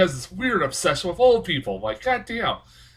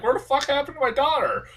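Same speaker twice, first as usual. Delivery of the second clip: high, mock frantic parent; a parent searching for a lost child; lightly voiced panicked family member; imitating worried parents; 12 semitones higher than usual. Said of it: "They're like".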